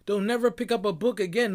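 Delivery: ordinary talk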